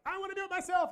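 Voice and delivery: put-on high, loud little-kid whine; whiny toddler. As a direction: squeal it like a stubborn two-year-old. Dad voices his two-year-old.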